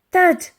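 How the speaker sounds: loud, high thudding bang; loud thud. A narrator's reading. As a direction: bang out the ram's blow. Door being rammed.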